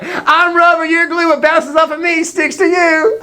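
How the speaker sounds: high singsong playground comeback; hammed-up high-pitched retort; mock childish yell. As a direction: jokingly taunt like a schoolkid. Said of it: "Watch what [name] yells back".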